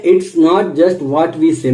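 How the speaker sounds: drawn-out, deliberate reading; slow quote. Shown as a demonstration. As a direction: read it slowly to the trainees. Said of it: "quote read slowly". (धीमा, सोच-समझकर पढ़ा गया उद्धरण)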